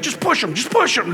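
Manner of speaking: high-pitched, urgent mock prodding; sneaky egging on. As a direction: urge it in a high little voice. Judas egging others on.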